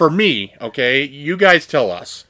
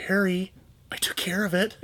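hushed, unvoiced whisper; 5 semitones higher, 9 dB quieter than usual. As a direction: whisper it like a secret. Quoting Dumb and Dumber line.

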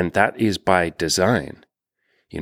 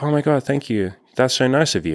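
higher, lightly voiced flattered girl; flattered woman; 6 semitones higher than usual. Woman thanking the nervous guy for his compliment, higher flattered voice. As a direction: play the woman charmed by the compliment. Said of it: "And she's like".

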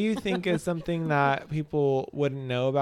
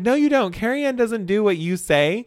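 higher and louder, insistent; hammed-up scolding of the boss; mock bossy correction; 7 semitones higher, 8 dB louder than usual. Assistant correcting the boss about his coffee, loud mock scolding. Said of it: "She's like".

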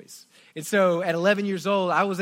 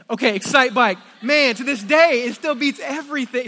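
excited outburst; 6 semitones higher, 8 dB louder than usual. Real, not acted. Gushing over a favourite game.

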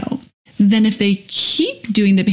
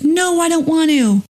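lightly voiced child, higher pitch; a hint of a stubborn child; whiny kid refusal; 7 semitones higher than usual. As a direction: play the kid saying no. Child refusing to brush teeth.

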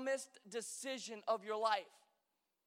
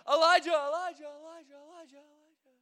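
loud calls fading to a near whisper; calling out like God; 4 semitones higher, 8 dB louder than usual. Retold or imitated. Mock God calling to Elijah, fading out. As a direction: call his name big, then let it fade away. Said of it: "God's not like".

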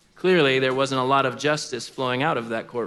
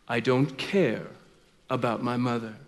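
unmoved judge; 5 semitones lower, 7 dB quieter than usual. Acted. Judge answers the pleading widow.

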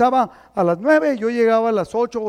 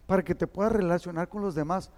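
a softer, gentle nudge; soft advice; 7 dB quieter than usual. Retold or imitated. Pastor gently telling a churchgoer to come early. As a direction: coax them kindly to come early. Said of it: "que le digo".

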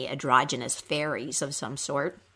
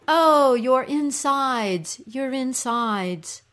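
slow, raised, sympathetic moan; echoed cry; 6 semitones higher, 5 dB louder, much slower than usual. Acted. Little creatures echoing the queen's pain, echoed cry. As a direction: echo her pain with stretched words. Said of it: "the little creatures cry".

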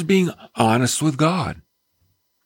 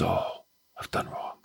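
soft broken whisper; whispered confession; 6 semitones lower, 12 dB quieter than usual. Acted. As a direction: confess it barely above a breath. Broken heart voiced confessing.

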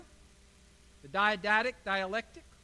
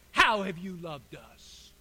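slow, lower sarcastic retort; dripping sarcasm; 5 semitones lower, slower than usual. Acted. People answering God's love, dripping with sarcasm. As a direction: sneer it slowly, with drawn-out scorn. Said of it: "of sarcasm".

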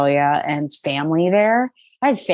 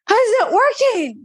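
baffled question; 3 dB louder than usual. Retold or imitated. Confused on the plane, retold.

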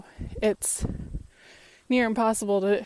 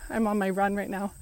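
normal talking voice

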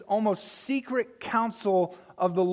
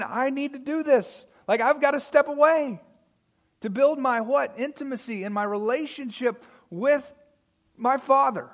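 lightly voiced Jesus, pitched higher; urgent first-person quote, raised a little in pitch; acting out Jesus; 5 semitones higher, 4 dB louder than usual. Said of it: "And he's going".

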